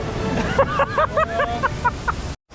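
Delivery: squealing, delighted laughter; high gleeful laugh. Real, not acted. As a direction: laugh in relief and glee. Triumphant laugh after ride.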